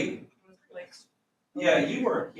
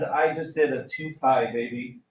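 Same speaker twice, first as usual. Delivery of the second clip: lightly voiced cool brag; mock bragging; 4 semitones lower than usual. Teacher acting a student bragging to friends, a touch cocky. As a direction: show off to friends. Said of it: "you go to your friends".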